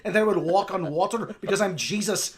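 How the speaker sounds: boastful put-on Irish accent; hammed-up Irish rock star, self-important. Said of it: "I'm Bono".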